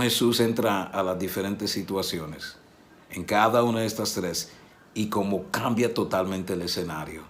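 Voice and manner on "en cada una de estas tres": ordinary talk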